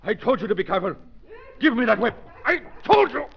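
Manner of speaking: villain raging at his bungling servant; furious shout. Acted. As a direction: demand the whip in a rage. Villain demands whip, furious.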